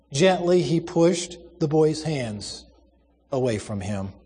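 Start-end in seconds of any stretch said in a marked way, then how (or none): none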